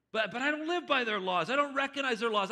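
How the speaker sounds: high, loud outraged protest; indignant shout. Acted. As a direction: refuse it at full volume. Richard protests death sentence.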